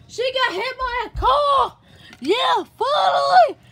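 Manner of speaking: loud, high play-acted cheer; gleeful shout. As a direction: shout the news out triumphantly. Cheers car accident in play.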